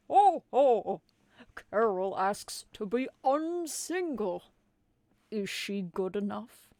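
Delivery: loud jolly ho-ho Santa; booming Santa. Acted. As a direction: boom it out like a big Santa. Santa voiced in story.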